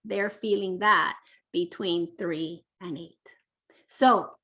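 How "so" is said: ordinary delivery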